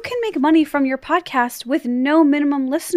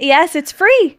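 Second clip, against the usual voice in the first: 1 hammed-up ad excitement; 5 semitones higher, 8 dB louder, slower than usual. Hammed-up ad read echo.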